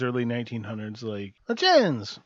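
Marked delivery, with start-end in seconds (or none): 1.5-2.2 high-pitched newcomer giving his name; mock immigrant. Immigrant off the boat gives his name to an official, mock high voice. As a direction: put on a higher voice as a new arrival.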